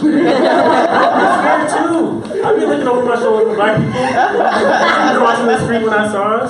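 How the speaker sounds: giggling while joking; laughing. Real, not acted.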